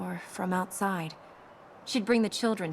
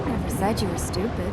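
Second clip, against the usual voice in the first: louder retort; 7 dB louder than usual. Real, not acted. Retort during banter.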